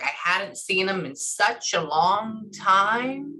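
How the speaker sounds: very slow, stretched wistful telling; imitated drawn-out speech. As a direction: linger long on the last words. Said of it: "That's how she says it".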